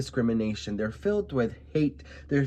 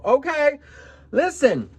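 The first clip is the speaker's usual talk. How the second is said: calling attention; 12 semitones higher, 6 dB louder, slower than usual. Real, not acted. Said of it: "calling viewers to attention".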